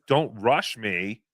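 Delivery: drawn out slowly. Retold or imitated. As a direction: read it slowly, deliberately. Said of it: "her sign says".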